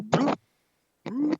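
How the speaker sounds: high, hollow sonar bloops; sonar ping. Retold or imitated. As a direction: imitate a submarine sonar ping. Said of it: "like a submarine sonar".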